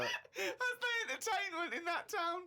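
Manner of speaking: high voice breaking with laughter; laughing incredulity. Real, not acted. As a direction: squeeze the words out through laughter. Still cracking up at the diary.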